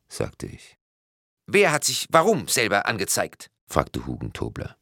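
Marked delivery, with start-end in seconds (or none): 1.5-3.5 high-pitched questioning voice; puzzled policeman. A narrator's reading. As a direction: play a baffled policeman, pitched up. Policeman's voice in story.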